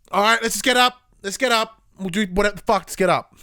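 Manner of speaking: loud, high giving-in; re-enacted frazzled dad outburst; exasperated surrender.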